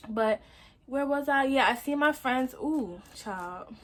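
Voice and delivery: usual speaking voice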